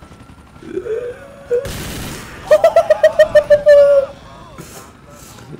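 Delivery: very high, loud cackling laugh; shrieking high laugh; high cackle. Real, not acted. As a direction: cackle wildly with glee. Cackling at burning enemies.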